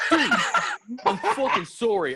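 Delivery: high, loud, laughing mock apology; frantic apology. To the girlfriend after the threat, a high, loud, laughing apology. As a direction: babble the sorry out through laughter, high and loud.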